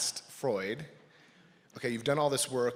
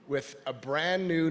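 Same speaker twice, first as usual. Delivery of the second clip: ordinary talk, nothing marked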